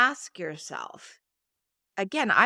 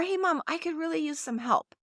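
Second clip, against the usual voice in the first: higher, assured request to Mom; confident child; 7 semitones higher, faster than usual. Shown as a demonstration. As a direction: ask openly, without any apology. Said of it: "There's confidence there".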